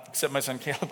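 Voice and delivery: ordinary delivery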